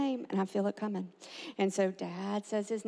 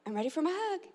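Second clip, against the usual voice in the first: put-on little girl; 8 semitones higher, faster than usual. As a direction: imitate a child asking sweetly. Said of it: "And then she would say".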